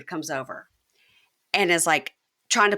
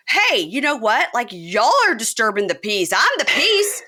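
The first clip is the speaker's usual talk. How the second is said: mock drunk ranting; 8 semitones higher, 4 dB louder than usual. Drunk man ranting at friends in a bar, high and loud. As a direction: rant like a drunk who thinks he's the law. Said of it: "And he goes".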